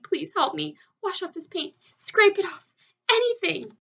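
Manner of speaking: high, loud desperate pleading; bawling plea. A narrator's reading. Centipede bawls to James to scrape off the paint. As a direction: beg at the top of your voice. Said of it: "bawled the centipede".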